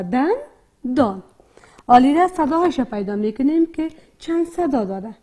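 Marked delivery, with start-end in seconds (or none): 0.0-1.2 دن lifted, a pause, then دان falling; two separate beats, دن and دان; split into syllables. Shown as a demonstration. Teacher showing two parts, دن and دان said separately. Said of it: "کلمهٔ دندان دو بخش داره".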